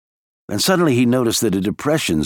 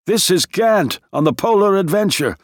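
higher shout over rumbling radio noise; 9 semitones higher than usual. A narrator's reading. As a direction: yell to be heard through the radio. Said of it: "He had to shout to be heard".

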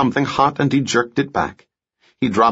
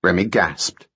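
plain reading voice

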